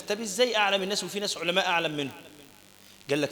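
normal talking voice

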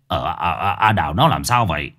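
flustered. Acted. Startled old man.

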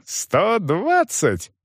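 high, very drawn-out outraged СТО ДВАДЦАТЬ; indignant emphasis. A narrator's reading. Young man insists on his payment, indignant. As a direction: stretch the sum out indignantly. Youth repeats the sum in outrage.

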